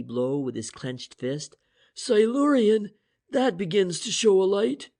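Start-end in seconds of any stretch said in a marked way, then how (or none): 1.9-4.9 loud, high and exultant; triumphant. A narrator's reading. French exulting over the decoded word to the others. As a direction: keep the victory in the voice. French's victory shout continues.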